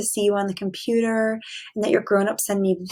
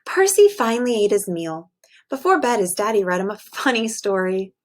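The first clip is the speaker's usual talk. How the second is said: expressive bedtime story read; reading to children. A narrator's reading. Teacher reading a picture book to her class, storytelling voice. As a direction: read it aloud to a class of little kids.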